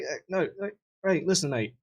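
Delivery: lightly put-on English accent; a hint of English accent, hedging; English accent, a touch flustered. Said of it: "I don't know why he got English".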